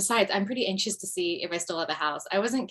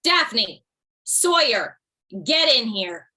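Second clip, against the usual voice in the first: loud, sharp call of two names, then the order; a mother summoning her kids, stern and loud; mom yell; 2 semitones higher, 8 dB louder, much slower than usual. Acted. Mom calling her kids in after a chaotic night, loud yell. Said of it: "Oh, good mom yell".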